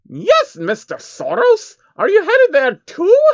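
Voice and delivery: very high, loud, slow beaming reply; proud and delighted; beaming Trixie. A narrator's reading. Trixie beams as she tells Soros her destination.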